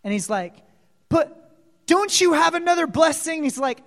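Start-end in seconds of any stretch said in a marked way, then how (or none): 1.1-3.4 desperate plea. Acted. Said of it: "began to weep bitterly".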